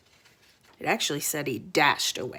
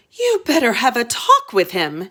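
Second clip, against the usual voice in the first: a hint of a firm queen; lightly voiced queen, insistent; queen's advice; 6 semitones higher, 5 dB louder than usual. Queen urging her husband, lightly voiced firm queen.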